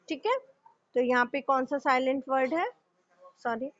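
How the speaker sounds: ordinary talk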